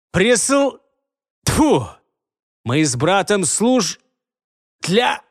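high spitting frustration; frustrated stammer. Acted. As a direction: spit out the failed word. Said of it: "напряжённая тишина".

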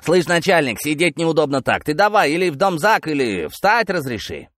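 cheeky, high-pitched heckle; insolent yell. Acted. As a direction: call out brazenly to the boss. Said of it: "нагло крикнул".

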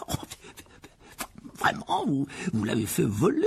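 hesitant, fascinated question; very interested. Acted. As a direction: let curiosity slip out. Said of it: "très intéressé". (très intéressé, hésitant)

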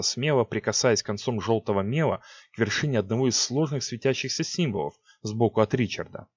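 usual speaking voice

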